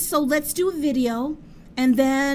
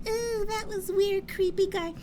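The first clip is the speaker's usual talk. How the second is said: mock squeamish girl; 10 semitones higher, 4 dB quieter than usual. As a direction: play a grossed-out girl. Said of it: "some girls that would be all".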